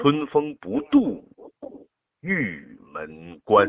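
teacher shows the stress on 度. Shown as a demonstration. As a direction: punch 度, then draw out 玉门关. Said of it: "重音在度上".

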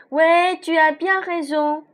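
high, loud lake spirit voice; lady of the lake. A narrator's reading. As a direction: give the lake lady a lifted voice. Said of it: "dit une voix venue du fond du lac".

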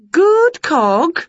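high, lively witch voice; bright eager witch teasing her pets; Winnie's voice. A narrator's reading. Witch quizzing the dog and Wilbur, high and lively.

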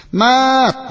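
high, loud, slow call of the cat's name; a boy calling his cat loudly again. Acted. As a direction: shout the name out long. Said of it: "N君大きい声で".

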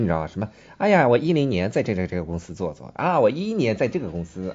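ordinary delivery

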